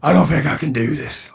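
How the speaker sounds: put-on a weak, slow Scottish accent; lightly put-on Scottish accent, worn out; bad Scottish accent. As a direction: slur it tired in a failing Scottish accent. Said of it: "I'm trying to do a Scottish accent".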